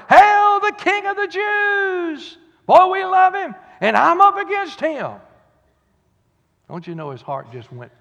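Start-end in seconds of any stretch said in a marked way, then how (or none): 0.1-2.4 much higher, louder, slow hail; crowd hollering. Retold or imitated. Preacher voicing the Palm Sunday crowd's hollering. 2.7-5.1 rising, shouting preacher build; preaching at full force. Acted. Preacher building to a shout for the congregation.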